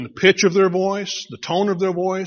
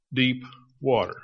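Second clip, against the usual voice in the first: ordinary talk, nothing marked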